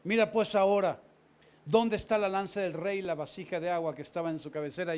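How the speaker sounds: loud, showing off the proof; loud mocking shout; loud taunt. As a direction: call out the taunt. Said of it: "Y dijo David a Abner".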